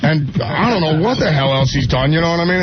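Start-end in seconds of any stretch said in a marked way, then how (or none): none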